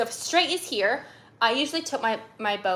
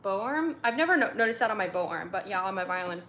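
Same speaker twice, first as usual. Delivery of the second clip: usual speaking voice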